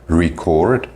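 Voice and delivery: "record" as the verb, ri-CORD; second-syllable stress. Shown as a demonstration. Teacher contrasting word stress, "record" stressed on CORD. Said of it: "word stress".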